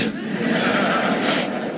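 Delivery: soft breathy laugh; a quick amused laugh. Real, not acted. Laughs at own prayer.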